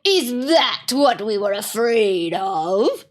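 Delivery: slow, sneering creature voice; scornful jeer. Acted. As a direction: drawl out the scorn. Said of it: "said another".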